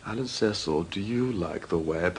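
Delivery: hushed menacing question; quiet menace. Acted. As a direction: lean in and threaten softly. Grilling the nerd menacingly.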